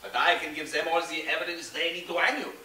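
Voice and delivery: a con man playing a Frenchman, French accent throughout; fake French accent. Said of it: "That doesn't sound very French, Pierre".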